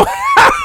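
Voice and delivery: high, howling burst of laughter; a sudden squeal of laughter; shrieking laugh. Real, not acted. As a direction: let a shrill laugh explode out. Cracks up at vacay joke.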